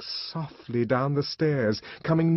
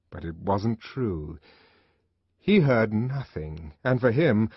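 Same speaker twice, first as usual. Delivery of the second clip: plain reading voice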